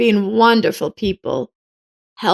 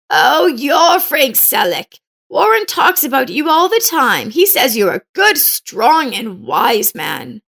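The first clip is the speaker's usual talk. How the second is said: put-on elderly neighbor woman; 6 semitones higher than usual. Old woman recognizes coach.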